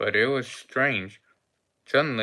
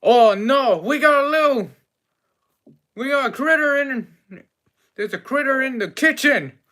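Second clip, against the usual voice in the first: high, loud wail from the chef; hammed-up, high-pitched alarm; 12 semitones higher, 6 dB louder than usual. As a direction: cry out in panic as the chef. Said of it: "my chef goes".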